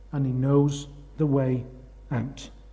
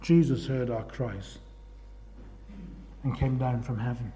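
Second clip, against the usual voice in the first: softer and lower, slowing to a hush; hushed awe; 6 semitones lower, 11 dB quieter than usual. Real, not acted. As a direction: let it fall quiet and reverent. Quiet awe over Jesus coming.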